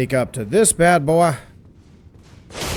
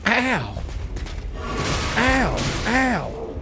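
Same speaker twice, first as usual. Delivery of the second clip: high pained yelps; 9 semitones higher, 5 dB quieter, much slower than usual. Real, not acted. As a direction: yelp at each hit. Getting hit repeatedly in game.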